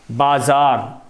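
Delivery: ordinary delivery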